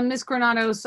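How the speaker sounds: ordinary delivery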